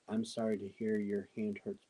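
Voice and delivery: usual speaking voice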